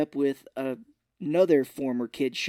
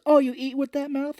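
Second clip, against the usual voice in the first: high, louder retold taunt; mock indignation; 12 semitones higher, 5 dB louder than usual. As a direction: act scandalized, pitched well up. Retells taunting comeback in quarrel.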